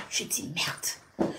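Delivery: breathy whisper under the breath; whispered merde to herself in shock; whispered curse. Retold or imitated. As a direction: swear to yourself in a whisper.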